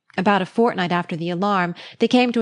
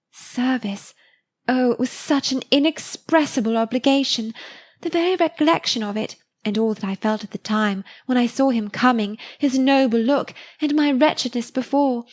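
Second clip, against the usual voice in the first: put-on young girl voice, much higher, rapturous; Harriet, gushing; 4 semitones higher than usual. Harriet to Emma about her rescuer, high rapturous girl. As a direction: gush like a girl in love, pitched high.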